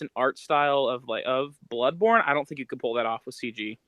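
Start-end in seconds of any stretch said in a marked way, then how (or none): none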